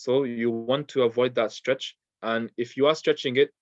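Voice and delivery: ordinary talk, nothing marked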